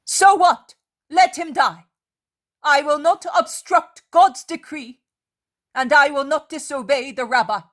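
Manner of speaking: a fierce, ringing refusal; defiant. A narrator's reading. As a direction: hurl the defiance at them. Mary defies the Pharisees.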